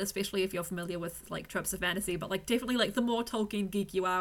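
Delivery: ordinary talk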